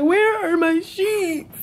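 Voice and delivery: high, slow imitation of a frantic mom; imitating mom. Imitating her mom hunting for lost sheets, high and drawn out. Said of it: "She said".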